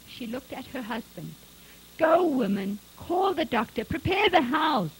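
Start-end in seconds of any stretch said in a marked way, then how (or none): 2.0-4.9 loud, rising commands; urgent command. A narrator's reading. Husband sending his wife for the doctor, urgent. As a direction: shout orders to hurry her off.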